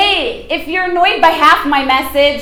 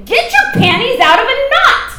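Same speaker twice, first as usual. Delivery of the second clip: laughing jab; 8 semitones higher than usual. Real, not acted.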